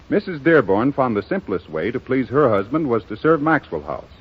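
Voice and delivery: ordinary talk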